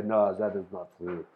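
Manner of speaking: low, softly put-on Russian accent; bad Russian accent. Voicing Russians denying the moon landing, shaky Russian accent. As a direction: attempt a rough Russian accent. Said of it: "I'm terrible at doing voices".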